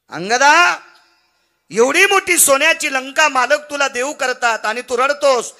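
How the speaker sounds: high, loud, incredulous demand; shouted questioning. Acted. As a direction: call him out at full voice. Acted shouted questioning of Angad.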